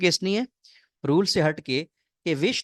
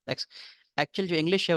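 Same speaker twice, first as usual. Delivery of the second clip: ordinary talk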